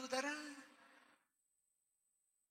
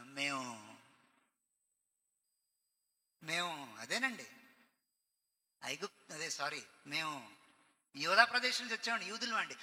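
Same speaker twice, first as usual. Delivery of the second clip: timid mumbling student; 6 semitones lower than usual. Acted. Nervous captive student answering the Babylonian teacher, low and hesitant.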